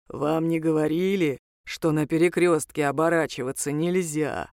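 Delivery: low, deep, courteous creature voice; deep-voiced civil reproach; polite demon. A narrator's reading. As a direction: inquire politely in a demon's bass. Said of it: "поинтересовался вежливо бес".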